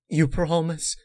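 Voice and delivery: high, hopeful plea; pleading question. Acted. Apple Rush asking Twilight for a promise, high plea. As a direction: ask it like a frightened patient. Dying mare seeking reassurance.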